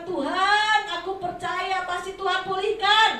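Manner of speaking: loud, high preaching proclamation; preacher leading the congregation in confession; faith declaration. Shown as a demonstration. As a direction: declare it boldly at full force. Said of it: "Saudara ucapkan".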